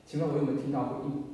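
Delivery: ordinary delivery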